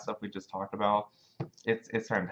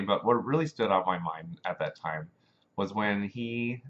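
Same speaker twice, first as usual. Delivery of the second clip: ordinary talk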